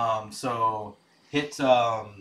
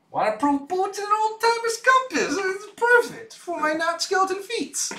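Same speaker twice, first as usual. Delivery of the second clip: skeleton voice. Acted. Skeleton pirate voice continues.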